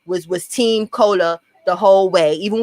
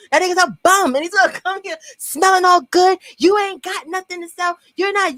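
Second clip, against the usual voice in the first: mock rant pitched far higher; retold outburst; 9 semitones higher than usual. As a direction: rant at him in a high, heated voice. Retold furious outburst, much higher.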